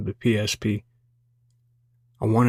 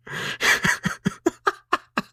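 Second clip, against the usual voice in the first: airy high-pitched laugh; breathy high cackle. Acted. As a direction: let out a squeaky breathless laugh. After the gift card.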